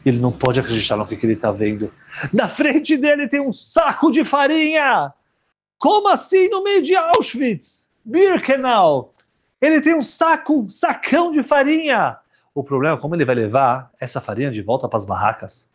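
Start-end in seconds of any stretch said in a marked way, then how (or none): 2.3-12.2 high, slow, astonished telling; stretched-out wonder at the find; amazed wonder. A narrator's reading. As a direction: marvel at it like a miracle. Preacher marvels at the flour sack.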